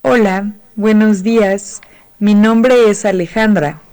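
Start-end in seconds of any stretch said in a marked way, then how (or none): none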